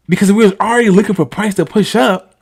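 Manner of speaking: loud, high, fired-up rush; excited outburst. Real, not acted. Trader rants to viewers about the missed entry, loud and excited. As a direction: blurt it out, worked up.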